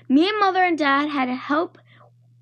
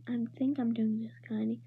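barely audible, much softer; very quiet; 3 semitones lower, 12 dB quieter than usual. Acted. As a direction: mumble it almost to yourself. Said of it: "said kinda quiet".

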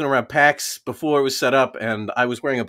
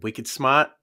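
tentative Boston accent. Shown as a demonstration. Guest tries a Boston accent on request, tentatively. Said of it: "your best Boston accent".

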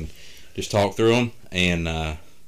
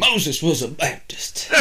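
high, slow mock revival preaching; hammed-up preacher; 9 semitones higher, slower than usual. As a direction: drag it out like a tent preacher.

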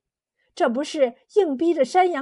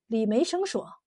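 ordinary delivery, nothing marked

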